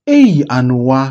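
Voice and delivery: loud, sharp "Hey"; acted scolding exclamation; shocked rebuke. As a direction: call out in reproach. Reprimand after daughter's insult.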